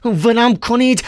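Ivan struggling. Acted. («ولم کنید» اول، بالا ولی مهارشده)

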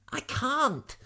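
high-pitched protest; frightened refusal. A narrator's reading. Lavender balking at the jump, high and frightened.

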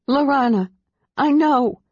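high, loud angry cry. A narrator's reading. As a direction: cut him off angrily. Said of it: "Kitiara cried angrily".

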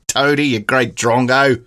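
hammed-up, drawn-out Aussie accent; broad Australian accent. Podcaster teasing in mock Aussie slang, broad Australian accent. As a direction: imitate a broad Australian, slowly. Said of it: "a bit more broad".

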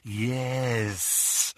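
a hushed serpent voice holding him; doubled with whisper. A narrator's reading. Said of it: "whispered the voice".

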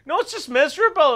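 high-pitched, exasperated correction; mock protest. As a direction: play a local talking down an eager newcomer. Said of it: "It's like".

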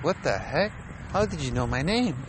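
high, baffled disbelief; startled victim. Retold or imitated.

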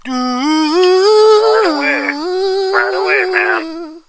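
high, slow, drawn-out mock sob; mock crying wail. Dad teased about crying at the song, high mock wail. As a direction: wail it like you're about to cry.

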